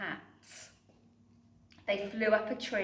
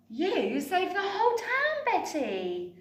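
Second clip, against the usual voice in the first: proud smile; 10 semitones higher, 4 dB louder than usual. Acted. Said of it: "smiled Mum".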